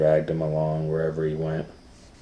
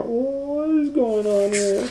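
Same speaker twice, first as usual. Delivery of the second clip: very high, slow squeal; bewildered little boy; alarmed child; slower than usual. Acted. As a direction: drag it out in disbelief.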